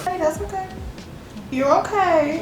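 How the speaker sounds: calm the nervous puppy; reassuring. Real, not acted. Comforting the puppy.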